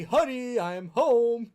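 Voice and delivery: high, loud sing-song greeting; cheery homecoming call. Retold or imitated. Spouse walking in the door calls out high and loud. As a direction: call it out like a sitcom husband coming home. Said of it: "it's like when you say".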